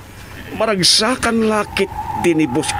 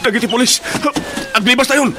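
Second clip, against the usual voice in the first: panicked shout; 6 semitones higher than usual. Acted. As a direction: yell at them to flee now. Police spotted, urging escape. (agpukkaw a mabutbuteng)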